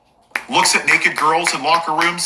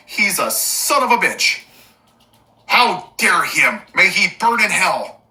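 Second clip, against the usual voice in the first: mock outraged bigot; slower than usual. Mocking outraged conservative voice.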